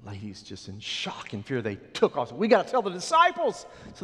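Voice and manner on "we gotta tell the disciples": high, loud and breathless with news; excited urgency. Acted. Women fleeing the tomb to tell disciples, excited and loud. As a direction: blurt it out as you run.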